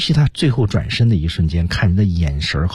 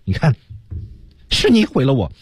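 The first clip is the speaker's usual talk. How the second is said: acted accusation, raised pitch; complaining; 6 semitones higher than usual. Teacher explaining the final turn toward others, acted complaint. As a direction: blame the listener, as if telling on them. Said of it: "表达的是一种要抱怨".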